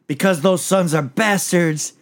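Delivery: a half-acted slow accusation; retold accusation. Host retelling the uncle's outburst at court, slow mock accusation. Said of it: "He's like".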